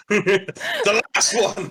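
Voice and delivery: high, drawn-out excited call; mock dramatic cry. Game master chiming in on the callout, mock dramatic cry. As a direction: draw it out high through laughter.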